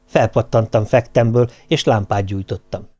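plain reading voice

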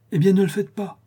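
high-pitched, firm verdict; decisive. A narrator's reading. Madame Guy telling Charlotte what to do, decisive. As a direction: settle it decisively, voice lifted. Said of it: "dit madame Guy avec décision".

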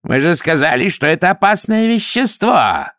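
high, furious wail; wailing shout. A narrator's reading. Downey wailing at Vimes, high and furious. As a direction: wail it, wagging a finger. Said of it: "завопил".

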